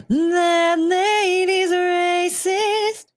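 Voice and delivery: high, slow sung princess voice; Disney princess. Pretend. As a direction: sing it sweetly like a cartoon princess. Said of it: "trying to sound like a Disney princess calling somebody racist".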